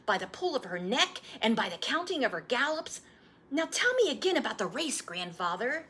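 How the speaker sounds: put-on an eager young boy begging for the story; boy's voice. Boy retelling race route.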